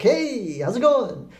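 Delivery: high, loud mock-cheery greeting; very up, happy. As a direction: imitate someone bursting in full of cheer. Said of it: "very up and they're very happy".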